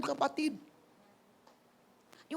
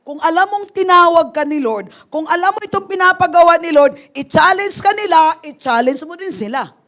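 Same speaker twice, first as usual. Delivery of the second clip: loud, raised-pitch challenge to the congregation; forceful preaching; 4 semitones higher, 14 dB louder than usual. Real, not acted. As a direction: press the charge home at full volume.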